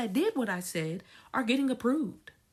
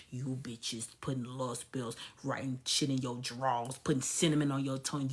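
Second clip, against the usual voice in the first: much lower and softer mutter; 13 semitones lower, 7 dB quieter than usual. Real, not acted. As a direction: grumble it under your breath. Muttered low rant.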